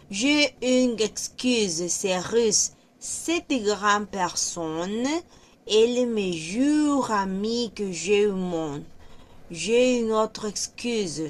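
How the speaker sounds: reading French slowly for students; careful French read-aloud; slow demonstration reading. Shown as a demonstration. Reads French sentences aloud.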